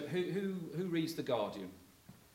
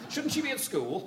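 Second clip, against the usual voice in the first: high, fussy mother's question; fussing mother; 11 semitones higher, 4 dB louder than usual. Retold or imitated. Son imitating his mother fussing at granddaughter, high fussy voice. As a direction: pitch it up like a fretting mum.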